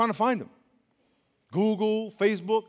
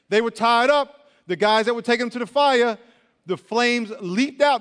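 dramatic preaching; 5 semitones higher, 8 dB louder than usual. Acted.

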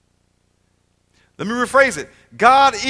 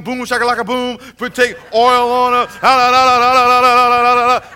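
hammed-up babbling tongues, louder as it goes; mock speaking in tongues; 3 semitones higher, 7 dB louder than usual. Preacher mocking showy healing with hammed-up tongues. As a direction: parody a showy faith healer chanting la la la. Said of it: "I got speak in tongues".

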